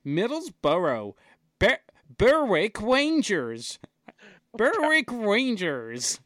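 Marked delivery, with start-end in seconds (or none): 1.6-6.2 Berwick Rangers said slow as BER-wick; spell-out Berwick Rangers, slow; American mispronunciation. Pretend. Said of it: "Instead of Berwick".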